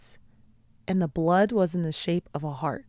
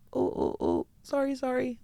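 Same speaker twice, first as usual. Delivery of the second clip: fighting back tears, then apologizing; real choked-up "oh, oh, oh, sorry"; 7 semitones higher than usual. Real, not acted. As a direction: voice catching with real emotion. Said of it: "I'm getting a little choked up".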